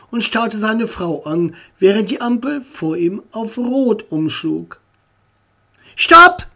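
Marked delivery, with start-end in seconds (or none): 5.9-6.5 sudden high, loud yell of alarm; shouted stop. A narrator's reading. Boy yelling at his father to brake. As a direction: yell it out as a warning. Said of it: "schrie Tommy".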